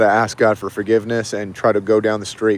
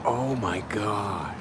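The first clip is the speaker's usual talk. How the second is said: stunned hush; 10 dB quieter, much slower than usual. Real, not acted. Stunned after seeing violence.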